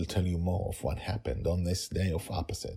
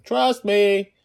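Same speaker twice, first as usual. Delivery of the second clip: put-on child voice; 13 semitones higher, 7 dB louder than usual. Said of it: "she said".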